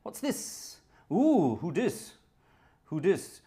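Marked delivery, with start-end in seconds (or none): 1.1-3.4 slow, high put-on voice; cheeky mock street voice; drawled teasing who dis; playful slang. Reading a donor name playfully.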